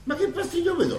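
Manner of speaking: high, outraged question; indignant protest. Acted. Protesting at being sent away. (protesta indignata, molto acuta)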